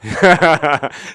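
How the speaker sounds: real laugh out loud; burst of laughter. Real, not acted. Gamer laughing at his scoreless team, loud laugh.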